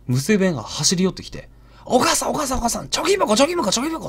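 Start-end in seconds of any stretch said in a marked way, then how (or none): 1.8-4.1 put-on higher, excited little girl calling her mother. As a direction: tug at mom, bursting with the news. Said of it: "って興奮してるんですね". (興奮した娘の高い声)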